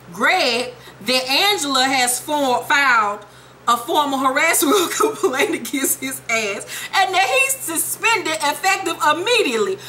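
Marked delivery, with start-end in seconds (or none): none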